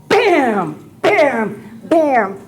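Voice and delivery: explosive mimed banging; imitated door kicks. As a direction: hit each bam like a kick.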